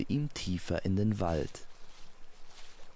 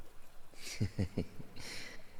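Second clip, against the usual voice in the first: quiet, airy giggle of a goddess; soft laugh; 8 dB quieter than usual. Acted. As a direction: laugh lightly under your breath. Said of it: "Sie lachte".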